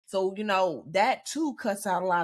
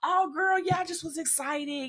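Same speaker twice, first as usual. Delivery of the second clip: put-on bubbly girlfriend excuse; 8 semitones higher than usual. Friend explaining her excited text.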